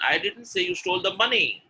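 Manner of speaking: emphatic, high "money"; stress on money. Shown as a demonstration. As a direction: save the weight for "money". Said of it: "And the last one".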